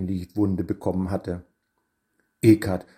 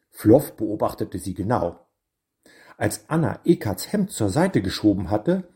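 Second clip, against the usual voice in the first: usual speaking voice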